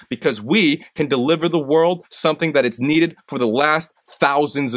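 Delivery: rallying speech rising to a peak; rousing pep talk. Acted. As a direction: fire up the audience like a coach. Motivational closing speech.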